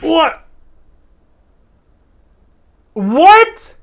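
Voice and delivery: high, stunned disbelief; a real double take, voice shooting up; loud incredulous what; shocked what. Real, not acted. Hearing [name] not blind claim.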